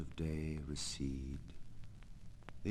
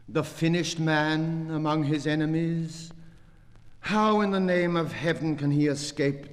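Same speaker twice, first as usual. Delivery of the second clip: louder and higher, building passion; impassioned declaiming; 4 semitones higher, 6 dB louder than usual. Acted. Poet reciting his verse, swelling into loud impassioned declamation. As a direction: declaim it with rising force. Impassioned climax of poem reading.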